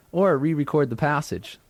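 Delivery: ordinary talk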